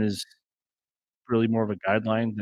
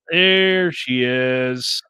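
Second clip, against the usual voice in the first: very slow, stretched-out announcement, louder; drawn-out greeting; 5 dB louder, much slower than usual. Real, not acted. Host greeting a viewer who just turned up, drawn out. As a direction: draw each word right out, louder.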